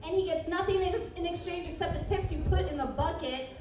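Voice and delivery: high, sing-song announcer intro building to loud; big stage introduction building to the name; emcee announcement. Acted. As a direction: hype the host like a showman.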